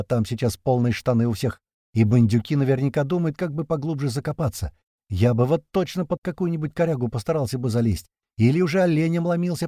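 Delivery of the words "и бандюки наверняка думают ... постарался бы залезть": plain reading voice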